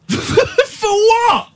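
incredulous laugh. Real, not acted. Host laughs at caller.

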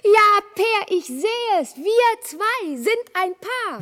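high, loud and overjoyed; a triumphant claim on her match; delighted cry. Acted. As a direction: burst out with glee. Troll girl delighted at the match.

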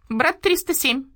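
high, flat radio-operator drone; a starling parroting a radio call in a high monotone. Acted. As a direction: repeat it on one high note like a relay. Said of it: "продолжил высоким монотонным голосом".

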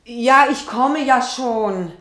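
loud and slow, calling to the next room; drawn-out call back. Acted. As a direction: call it out so they hear you next door.

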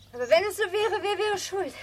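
teasing. Acted. Effi teasing her mother back.

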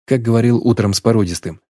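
usual speaking voice

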